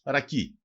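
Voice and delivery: ρακί cut to two syllables, stressed on the last; dialect pronunciation. Shown as a demonstration. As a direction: say ρακί as ra-KI, with no final a. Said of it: "Προφέρεται και ως".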